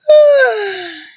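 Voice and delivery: long loud yawn rising then falling; big yawn. Acted. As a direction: stretch into a sleepy yawn. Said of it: "I don't know why I'm yawning".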